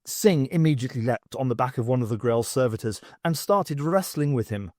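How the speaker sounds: ordinary delivery, nothing marked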